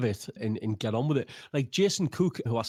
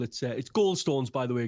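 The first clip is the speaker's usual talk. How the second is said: ordinary talk, nothing marked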